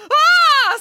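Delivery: high startled scream, loud. Acted. Michael screams as the wolf jumps out, a high loud shriek. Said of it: "screamed Michael".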